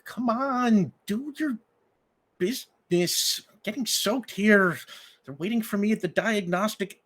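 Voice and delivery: woman coaxing her dog in the rain; pleading young woman. Acted. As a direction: beg the dog to hurry up in the rain. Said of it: "she pleads with Dog".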